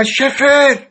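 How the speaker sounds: loud high call; shout. A narrator's reading. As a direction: yell his name across the house. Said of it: "و داد زد".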